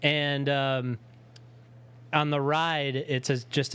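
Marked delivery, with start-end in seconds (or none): none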